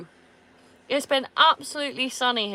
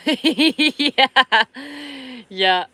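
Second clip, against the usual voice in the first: laughing; 8 semitones higher, 13 dB louder than usual. Real, not acted. Laughing with the dog.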